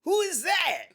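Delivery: high mock yelp; high mock machine yelp; pained machine. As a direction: yelp like the cabinet being stepped on. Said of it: "it goes".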